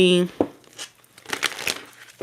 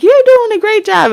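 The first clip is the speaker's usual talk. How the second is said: much higher and louder, sing-song fake praise; fake parent praise; 6 dB louder than usual. Higher, louder sing-song praise to your kid. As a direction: gush like praising a kid's bad drawing. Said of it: "you know how you be like".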